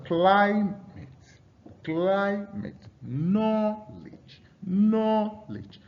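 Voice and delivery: "climate" and "Knowledge" with CLI and KNOW high and long; stress on first syllable. Shown as a demonstration. Teacher demonstrating noun stress, first syllables high and held. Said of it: "typically stress the first syllable".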